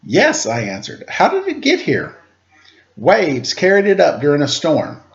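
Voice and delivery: storybook read-aloud, gently paced; story narration. A narrator's reading. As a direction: read it like a picture book to children. Reads a picture book aloud.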